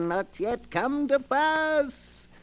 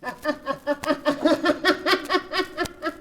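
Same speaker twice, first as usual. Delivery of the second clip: higher, gleeful villain's cackle; high sinister laugh; 6 semitones higher than usual. Acted. Keeper of the book signing off to listeners, sinister cackle. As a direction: cackle with dark delight.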